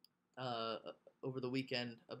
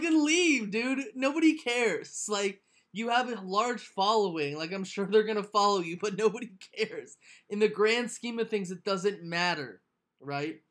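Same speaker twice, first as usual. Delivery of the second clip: exasperated retort; 5 semitones higher, 7 dB louder than usual. Retold or imitated. Retorting to the quitter.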